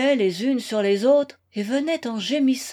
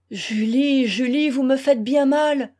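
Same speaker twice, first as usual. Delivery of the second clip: pained appeal, slightly raised; wounded cry; 3 semitones higher, 4 dB louder than usual. A narrator's reading. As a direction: cry out to the one hurting you. Wounded lover cries out to Julie. (plainte douloureuse)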